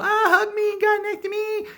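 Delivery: very high, loud screechy wail; hammed-up falsetto old woman; old lady panicking. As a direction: shriek like a frightened old lady. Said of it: "old lady's like".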